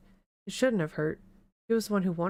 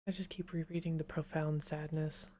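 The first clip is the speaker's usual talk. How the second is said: whispering. Real, not acted. Weary aside to viewers.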